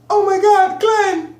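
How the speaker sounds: mocking Maggie's accent. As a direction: parody her mixed accent in a high put-on voice. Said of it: "it sound almost country, but street at the same time".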